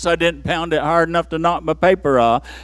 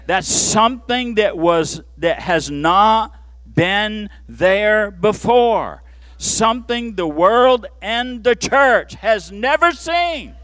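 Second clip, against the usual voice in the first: ordinary delivery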